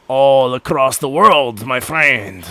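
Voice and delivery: slow, low fake French accent; drawn-out mock French, rather grand; bad French accent. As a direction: do a worldly Frenchman, badly. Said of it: "do a really bad French accent".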